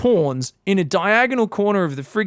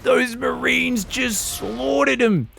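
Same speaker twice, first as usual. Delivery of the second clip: higher, slow drawn-out cry; dismayed wail; 5 semitones higher, much slower than usual. Real, not acted. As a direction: wail at the loss. Caster sees Vikings slaughtered.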